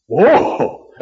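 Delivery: knocked breathless by her; stunned gasp. Acted. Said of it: "like he's been punched in the gut".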